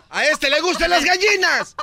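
loud, high mock-outraged accusation; shouting accusation. Lawyer shouting at the judge about the chicken abuser. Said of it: "el señor está gritando".

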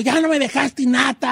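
hammed-up shout over lost nata; mock family yell. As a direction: play the sibling griping that the nata is gone. Family fighting over nata, acted quote.

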